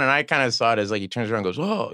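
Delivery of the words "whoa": high, brief startled whoa. Retold or imitated. Character turns around to see his foe, startled. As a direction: act mildly taken by surprise. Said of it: "you know, he's startled".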